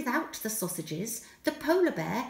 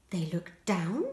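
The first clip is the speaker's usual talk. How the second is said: hushed; 6 semitones lower, 4 dB quieter than usual. A narrator's reading. Hushed search.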